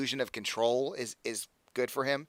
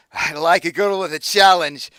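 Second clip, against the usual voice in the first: louder put-on accent, gloating; accented villain menace; 5 semitones higher, 12 dB louder than usual. Patron gripping her neck, gloating accented menace. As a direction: purr a threat while squeezing. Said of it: "I love the accent".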